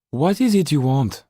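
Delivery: put-on a firm lady questioning the boy; Mrs Laxton voiced.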